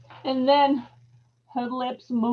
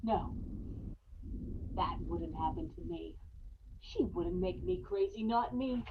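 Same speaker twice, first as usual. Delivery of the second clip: low, much softer self-reassurance; murmured refusal; hushed denial; 4 semitones lower, 11 dB quieter than usual. Acted. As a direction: talk yourself down quietly. Man steadies himself, hushed.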